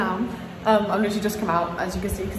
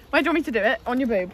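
usual speaking voice

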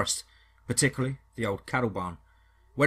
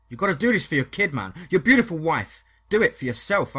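louder and higher, pressing hard; raised, urgent appeal to a scared friend; urgent plea; 5 semitones higher, 6 dB louder than usual. Acted. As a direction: push him to fight for his family. Urging scared friend to help.

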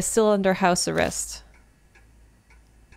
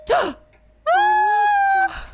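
much higher, loud joyful cry; excited shout; 4 dB louder than usual. Real, not acted. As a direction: burst out with joy. Sees Ami wake up.

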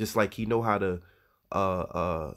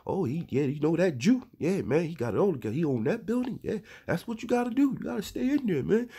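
put-on much higher, admiring old-timer voice, quick in the middle; imitating older men; 8 semitones higher than usual. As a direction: do the old men praising the rich neighbour, pitched way up. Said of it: "these older men talk like that".

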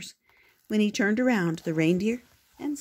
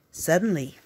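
ordinary delivery

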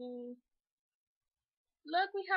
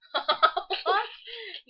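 loud laugh; 5 semitones higher, 10 dB louder than usual. Real, not acted. Laughing at toddler.

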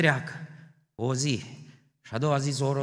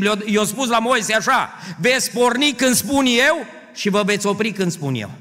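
louder, higher preaching, climbing; full-force preaching; 8 semitones higher, 7 dB louder than usual. Acted. Preacher rousing the church about God's constancy, fervent loud preaching. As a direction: hammer the congregation with conviction.

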